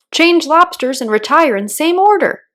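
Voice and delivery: excited. A narrator's reading. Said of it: "continued the Gryphon".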